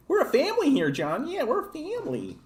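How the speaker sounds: squeaky parody of an action-movie tough guy; mock Vin Diesel, squeaky. Streamer mocking a movie family speech, squeaky high voice. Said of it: "Vin Diesel".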